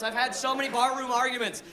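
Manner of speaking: ordinary delivery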